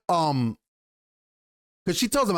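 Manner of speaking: normal talking voice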